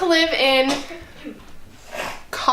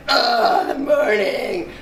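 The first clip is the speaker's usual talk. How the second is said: groggy zombie groan; much slower than usual. Pretend.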